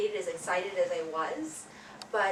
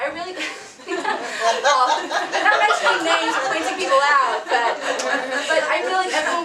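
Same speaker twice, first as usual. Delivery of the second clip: giggling through the words, louder and higher; laughing; 7 semitones higher, 12 dB louder than usual. Real, not acted. Actress praising castmates, laughing through it. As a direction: laugh while trying to praise everyone.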